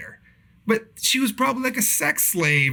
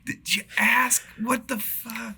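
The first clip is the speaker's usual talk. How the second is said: much higher incredulous question; mock disbelief; 9 semitones higher, 4 dB quieter, slower than usual. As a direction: react in disbelief to the text. Retold reaction to text.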